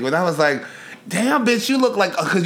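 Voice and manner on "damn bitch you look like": excited. Retold or imitated. Quoting own hyped reaction.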